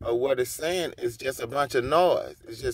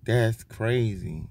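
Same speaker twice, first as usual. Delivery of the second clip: ordinary talk, nothing marked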